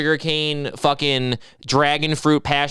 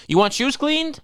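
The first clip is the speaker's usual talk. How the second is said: high pushy shoe cleaner; the shoe cleaner pushing his service, high; mock shoe cleaner; 11 semitones higher, slower than usual. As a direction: offer a shoe shine, pitched high.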